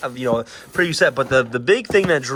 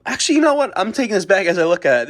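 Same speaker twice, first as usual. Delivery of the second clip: sudden realization; 8 semitones higher, 5 dB louder, much faster than usual. Real, not acted. Changes mind suddenly.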